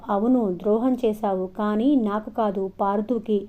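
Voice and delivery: ordinary delivery, nothing marked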